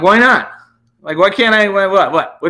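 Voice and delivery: high, taunting questions; mocking challenge. Retold mocking challenge to the cop.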